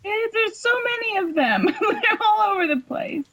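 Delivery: high, laughing through words; giggling while talking. Real, not acted. Laughing at boner pictures.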